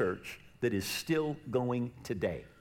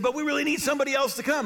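eager; 12 semitones higher, 4 dB louder, faster than usual. Retold or imitated. Retold friend's request.

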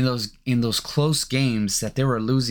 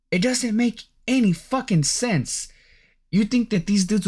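exasperated; 8 semitones higher than usual. Real, not acted. Ranting about Luka's weight.

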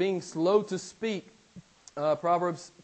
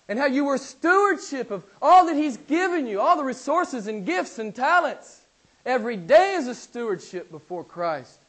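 loud, high preacher's cry; climbing pulpit fervor; preaching at full force; 7 semitones higher, 7 dB louder than usual. Acted. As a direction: press it on the church with fire.

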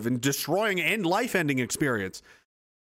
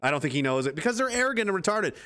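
ordinary delivery

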